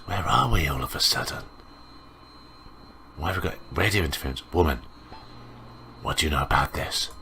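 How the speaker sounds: soft, half-whispered reading of a shadowy exchange; murmured noir dialogue; hushed mystery reading. Acted. Reads mysterious game dialogue aloud.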